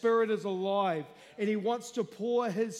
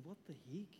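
inner thought; 7 semitones lower, 18 dB quieter, much faster than usual. Retold or imitated. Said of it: "I was thinking".

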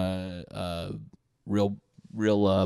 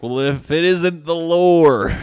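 put-on louder, slowed, sneering drunk heckler; drunk dude; 9 semitones higher, 12 dB louder, slower than usual. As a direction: slur it out like a mocking drunk. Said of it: "some fucking drunk dude's like".

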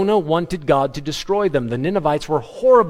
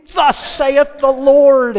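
much higher, louder, slow booming proclamation; prophet's shout; 11 semitones higher, 8 dB louder, much slower than usual. Retold or imitated. Jeremiah preaching to wicked passers-by, booming slow shout. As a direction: thunder it like a prophet who can't hold it in.

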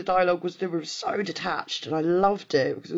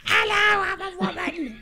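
much higher mock lady voice; man doing a woman's voice; 13 semitones higher than usual. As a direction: squeak it out like a cartoon woman. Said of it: "my real voice or my lady voice".

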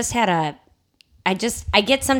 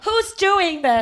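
mock hurt comic; 10 semitones higher, much slower than usual. Cohost echoes the hurt comic.

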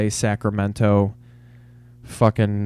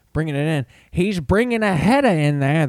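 a rough imitation of a sportscaster calling a goal, pitched higher; hammed-up play-by-play caller, slightly raised voice; Al Michaels impression; 6 semitones higher than usual. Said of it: "That's my impression of Al Michaels".

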